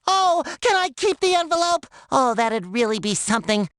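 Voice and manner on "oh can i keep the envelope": imagined winner. Pretend. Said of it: "And then I'd say".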